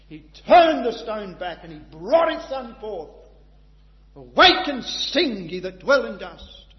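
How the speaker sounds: loud, high preaching, building with fervour; full-force preaching. Acted. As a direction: preach it out to the hall with conviction.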